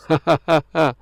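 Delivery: short real chuckle; brief laugh between phrases; amused little ha-ha-ha. Real, not acted. Preacher chuckles at own remark.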